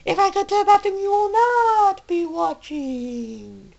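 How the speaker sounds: high, soft voice slowing to a crawl; playful high disclaimer. Real, not acted. Vlogger joking to viewers about bathroom trips, high and drawn out. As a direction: pitch it way up, then stretch the ending.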